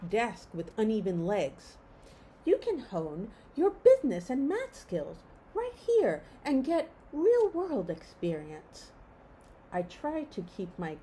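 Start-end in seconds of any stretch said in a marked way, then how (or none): 2.4-8.9 prim teacher voice. Acted. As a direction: sell the dull job like a cheery teacher. Teacher Miss McDaniel voiced.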